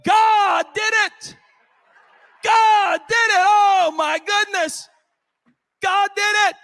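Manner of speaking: high, loud drawn-out hollers, voicing the woman; jubilant shouting. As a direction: shout it out in amazed praise. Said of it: "She started shouting".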